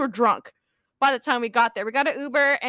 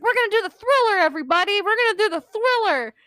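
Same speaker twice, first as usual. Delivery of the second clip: lightly mock rallying call, higher and lively; rallying the crowd; 7 semitones higher, faster than usual. Zombie dancer gathering everybody for the Thriller, eager rallying. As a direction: imitate a hype man, pitched up. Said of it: "trying to get everybody to come".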